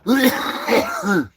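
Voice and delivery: real high-pitched burst of laughter. Real, not acted. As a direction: crack up at your own joke. Laughs at own risky joke.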